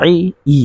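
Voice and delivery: first ই from the throat, second ই from mid-tongue; throat, then mid-tongue. Shown as a demonstration. Teacher showing where each letter comes from, articulated apart. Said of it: "একটা হলক থেকে আরেকটা জিহ্বার মাঝখান থেকে".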